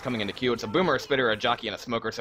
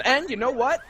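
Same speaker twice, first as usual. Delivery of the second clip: excited; 9 semitones higher than usual. Real, not acted. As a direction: sell the surprise to viewers. Caster reacts to surprise play.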